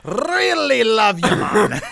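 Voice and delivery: mock shout with a long trilled R in "Really"; slow, over-rolled R in "Really". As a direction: trill the R of "Really" hard, loud and high. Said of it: "they roll their R's".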